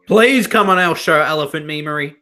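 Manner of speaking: loud high-pitched begging; pleading. Real, not acted. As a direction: beg loudly for him to come on. Begging a YouTuber, repeats louder.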